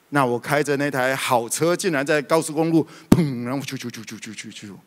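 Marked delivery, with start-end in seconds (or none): 3.1-4.7 mouthed bang then skidding squeals; playful crash-and-skid sound effects; crash noises. Retold or imitated. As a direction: imitate a car crash and screeching skid. Imitates car crash and skid.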